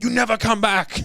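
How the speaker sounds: high, loud banishing shout; hammed-up furious yell; mock angry yell. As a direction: shout it like a boss throwing someone out. Acting out bosses kicking him out.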